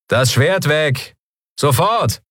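high, drawn-out order from a pirate; commanding pirate. A narrator's reading. Armed pirate to the woman with the sword, commanding. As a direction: order them to disarm at once.

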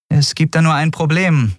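high-pitched captain's voice; woman captain. Acted. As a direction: raise the catch in a high voice. Captain raises a problem.

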